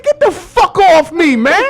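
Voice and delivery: high, loud, drawn-out demand; acted angry shout. To the man grabbing him, acted angry shout. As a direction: yell it back in a retold quarrel.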